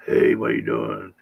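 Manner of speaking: low, drawn-out mock phone voice; prank caller voice. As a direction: draw it out low and slow, as a prank. Acting out a prank phone call.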